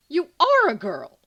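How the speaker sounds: loud high yelp of delight. A narrator's reading. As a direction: blurt it out in shocked glee. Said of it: "James yelps".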